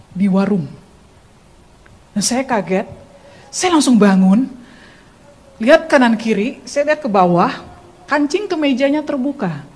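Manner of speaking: normal talking voice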